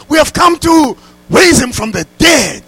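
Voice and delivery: high, loud, shouted declaration; zealous shout. Retold or imitated. Zealous pastor tells the mortuary attendant, shouting. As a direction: belt it out like a fired-up pastor. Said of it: "my pastor was so zealous".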